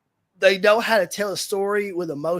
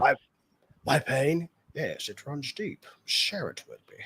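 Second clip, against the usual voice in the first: put-on Sean Connery accent; 2 semitones lower, 4 dB quieter than usual. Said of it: "in his dialect".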